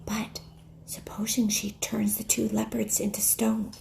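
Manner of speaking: hushed worried whisper. Acted. Lucy whispering her fear to Peter. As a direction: breathe it under your breath. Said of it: "whispered Lucy to Peter".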